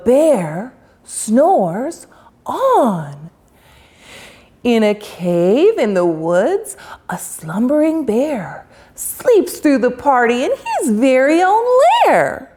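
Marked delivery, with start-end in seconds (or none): none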